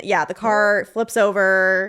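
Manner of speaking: usual speaking voice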